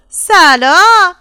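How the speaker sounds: loud, high, drawn-out hello; little newcomer calling out slowly and loudly; tiny child's greeting. A narrator's reading. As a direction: burst out with a long, ringing greeting.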